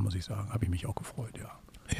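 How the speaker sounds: whispered aside, voiceless; whispering. Real, not acted. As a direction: breathe it as a secret. Geflüsterte Wiederholung. (leise geflüstert, ohne Stimme)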